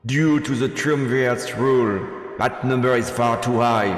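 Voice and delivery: loud, ringing speech to a crowd; rallying speech. Acted. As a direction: address the cheering crowd like a rebel leader. Rebel leader's rally speech.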